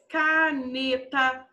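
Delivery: drawn out, syllable by syllable; slow dictation. Shown as a demonstration. Teacher giving pupils a three-syllable example, slowly dictated.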